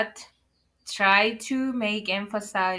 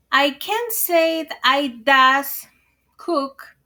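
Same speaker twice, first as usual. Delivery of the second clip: higher and louder, slowly marking the wrong "does"; mistake said loudly; 5 semitones higher, 7 dB louder, slower than usual. Shown as a demonstration. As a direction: warn the class by hammering the error "does". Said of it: "'cause that's totally grown".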